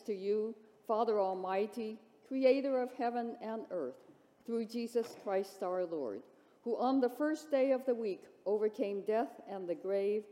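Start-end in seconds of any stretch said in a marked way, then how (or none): none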